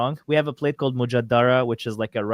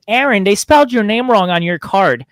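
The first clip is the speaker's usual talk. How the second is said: higher, louder mimicked complaint; indignant customer; 6 semitones higher, 7 dB louder than usual. Woman telling Aaron his card is misspelled, mock indignant. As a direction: imitate a woman loudly pointing out a mistake.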